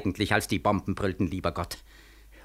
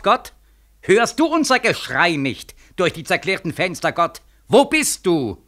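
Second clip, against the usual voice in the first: anguished demand; 5 semitones higher than usual. Acted. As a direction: hurl the questions at God. Crying out to God.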